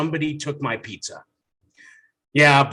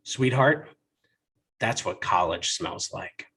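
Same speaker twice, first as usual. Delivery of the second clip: lower, soft fatherly explanation; a dad dodging his daughter's question, softly; soothing dad; 4 semitones lower, 4 dB quieter than usual. Retold or imitated. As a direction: hush it gently to the little girl. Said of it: "could think to muster up".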